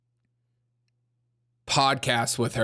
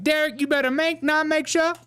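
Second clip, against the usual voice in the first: high-pitched mock stepmother; 13 semitones higher than usual. Stepmother telling Derek what he better do, high-pitched impression. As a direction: put on a much higher voice, scolding as the stepmother. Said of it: "That's how she talks to me".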